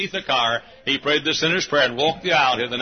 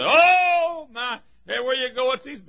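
high wailed "Oh", complaining voice; mock exasperation; 9 semitones higher, 3 dB louder than usual. Townsfolk run into soul winners all over town, mock wail. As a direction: imitate a fed-up townsperson, pitched well up.

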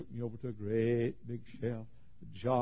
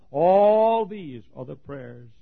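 loud and high, rushing, then a ringing question; preaching at full force; 3 semitones higher, 16 dB louder, much slower than usual. Acted. Preacher to his congregation, asking about the ringed men, preaching loudly. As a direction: cry it out over the crowd. Slow, drawn-out preaching line.

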